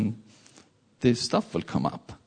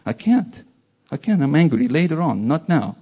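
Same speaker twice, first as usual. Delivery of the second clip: higher, louder, desperate I can't; angry outcry; 6 semitones higher, 7 dB louder than usual. Retold or imitated. Pastor reliving angry prayer to God, loud desperate outcry. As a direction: cry out to God in raw frustration.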